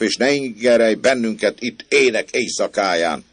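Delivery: high, loud neighbourly telling-off; indignant scolding. Acted. Roused neighbours tell him to let them sleep, scolding. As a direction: berate the troublemaker from an upstairs window.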